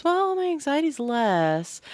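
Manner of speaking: high, drawn-out quote of a client; retold relief.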